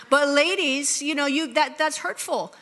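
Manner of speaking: usual speaking voice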